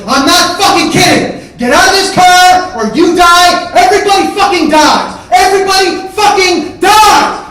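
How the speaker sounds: very high, slow full-throated scream; screamed threat. Retold or imitated. Man over a roaring engine to his brother, screaming. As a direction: stretch out each word at the top of your lungs.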